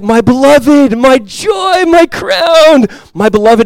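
high, loud, gushing endearment; loving Paul. Retold or imitated. Paul gushing love to the Philippians. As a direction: gush over them like a doting apostle. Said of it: "Paul is leading with this tone of love".

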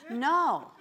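loud, high flat refusal; emphatic no. Acted. Preacher rejecting the devil's excuse, loud and emphatic. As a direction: shut it down hard. Preacher answers the mock apology.

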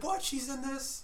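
high, hushed disbelief; retold surprise. Viewer spotting a favorite actress in the movie, surprised. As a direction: squeak it softly as a puzzled double-take.

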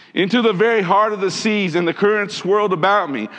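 ringing, emphatic reading of a plea to God; impassioned scripture reading. Acted. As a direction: preach the prayer out to the congregation. Reads Jonah's prayer with force.

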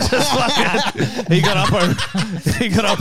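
laughing through the words, slowed; can barely get it out for laughing. Real, not acted. Laughing hard while telling the toaster story.

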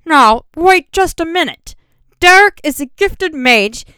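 high, loud, slow sputtering protest; spluttering. A narrator's reading. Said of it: "Master Bly came up spluttering".

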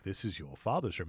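soft, low solemn line; low quiet mentor voice. Retold or imitated. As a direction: murmur it like a wise mentor. Freeman quoted, low and soft.

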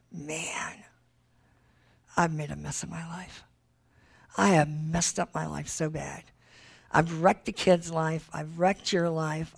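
a hint of a remorseful husband, hushed; hushed confession. Husband confessing at restaurant table.

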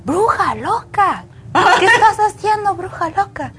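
mock the woman yelling "bruja loca" from her car; imitating a screaming woman. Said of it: "Y me gritaba". (imitando a una señora que grita, aguda y burlona)

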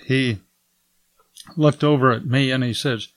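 normal talking voice

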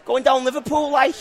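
high Liverpool phrase with tagged-on "like"; scratchy Scouse accent. Shown as a demonstration. Pub owner showing the added word, Scouse accent. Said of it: "Then at the end they say".

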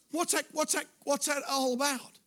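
usual speaking voice

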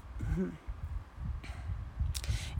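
soft breathy real laugh at a slip; laughing at her own stumble. Real, not acted. Reader laughing after fumbling a word, real soft laugh. Reader breaks off mid-sentence.

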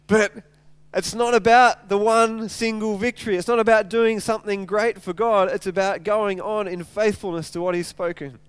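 ordinary talk

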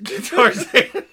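laughing. Real, not acted. Laughing repeat of joke.

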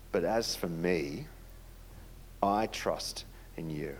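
slow, soft scripture reading, fading to a whisper at the end; read slowly. Shown as a demonstration. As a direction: linger on every word and let the last lines drop to a whisper. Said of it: "I'm gonna read it slow".

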